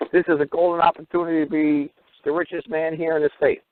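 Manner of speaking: high-pitched, tickled delight; hammed-up wonder, just after a chuckle; gleeful mock awe. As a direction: marvel at it in a lifted voice. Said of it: "this is the golden opportunity".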